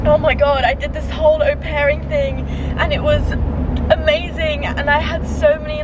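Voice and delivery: high, choked up but happy; proud, a little overwhelmed; emotional and teary. Real, not acted. Emotional farewell to au pair time.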